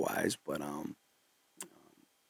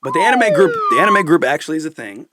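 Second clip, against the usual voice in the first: excited; 8 semitones higher, 14 dB louder than usual. Real, not acted. Excited about anime group.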